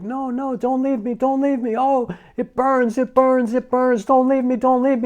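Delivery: high, distressed cry from a nightmare; panicked pleading. Retold or imitated. Dying father pleading in a morphine dream, panicked. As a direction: beg in pain not to be left. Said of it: "all of a sudden he was like".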